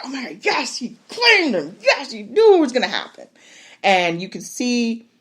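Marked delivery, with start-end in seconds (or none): 0.0-3.3 higher, thrilled cheering; excited. Retold or imitated. Viewer cheering the claiming, thrilled and high. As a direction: cheer him on, delighted. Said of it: "You're like".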